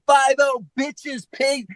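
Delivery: high hammed-up gangster voice; mock rapper rant. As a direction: spit it like a cartoon rapper. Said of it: "keeps saying".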